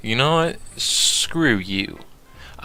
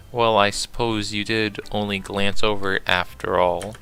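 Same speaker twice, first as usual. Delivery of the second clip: ordinary talk, nothing marked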